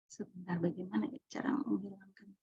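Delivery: whispered aside to herself. Real, not acted. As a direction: breathe it under your breath. Aside while fixing screen.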